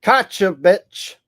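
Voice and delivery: high and loud, triumphant jeer; gleeful gotcha. Real, not acted. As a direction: pounce on it like you caught him. Catching Trump contradicting himself.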